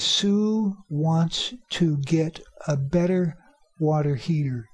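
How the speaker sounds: each word slow and separate; slow careful reading. Shown as a demonstration. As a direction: read it out word by word. Careful take before the quick one.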